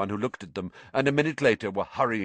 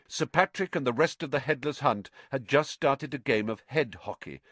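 ordinary delivery

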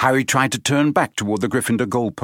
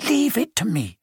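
put-on determined girl voice; 7 semitones higher than usual. A narrator's reading. Hermione resolute.

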